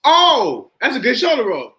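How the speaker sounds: mock-impressed, high exclamation on Oh; high, punchy "oh!" of approval; impressed coach. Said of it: "he was like".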